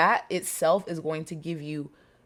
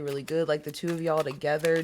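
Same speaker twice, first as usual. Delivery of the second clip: ordinary talk, nothing marked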